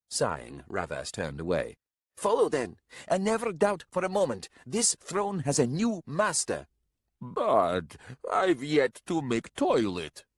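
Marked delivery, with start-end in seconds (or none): 2.2-6.7 put-on high, commanding young warrior; haughty young leader. A narrator's reading. 7.2-10.2 slow, raised cry of dismay. A narrator's reading. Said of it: "cried Garalko in sudden dismay".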